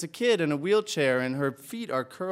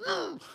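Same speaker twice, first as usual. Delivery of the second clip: a quick high soft hum; a coded little hum to his mom; secret mm signal; 14 semitones higher, 7 dB quieter than usual. Retold or imitated. Boy signals his mother he has a loogie, soft high hum. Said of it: "I'm gonna go, 'Mm,'".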